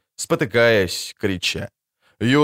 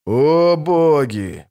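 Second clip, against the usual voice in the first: groaning; 3 semitones higher, much slower than usual. A narrator's reading. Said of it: "простонал купец".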